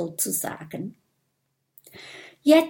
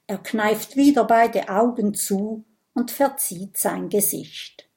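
usual speaking voice

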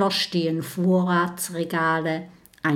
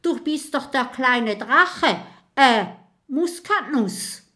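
higher, flustered, stammering; startled ghost; 5 semitones higher, 3 dB louder than usual. Acted. Startled ghost stammering at the dragon. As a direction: caught off guard, fumbling for the name. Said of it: "Die Gestalt erschrickt".

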